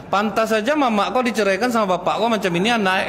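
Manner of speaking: high, sharp shut-up retort; mock angry retort. Spouse telling the other to shut up, mock angry. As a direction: bite back at the spouse. Quarrel continues.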